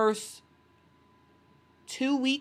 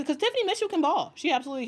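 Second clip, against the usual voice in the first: bursting up high and quick with pride; excited; 9 semitones higher, much faster than usual. Real, not acted. Streamer hyping Tiffany Mitchell to chat, excited and high. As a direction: hype her up, fast and high.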